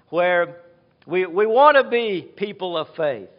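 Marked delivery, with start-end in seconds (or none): none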